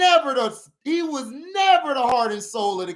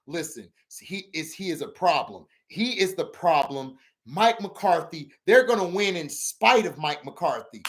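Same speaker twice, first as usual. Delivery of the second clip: lower, lightly voiced pundit rant; a hint of the TV pundit, lower and grave; mock Skip Bayless; 9 semitones lower than usual. Streamer mimicking Skip Bayless's blame, lower pundit voice.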